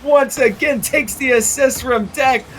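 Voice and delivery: high, loud thrilled call; excited play-by-play. Real, not acted. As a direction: ride the comeback hype. Caster reacts to comeback goal.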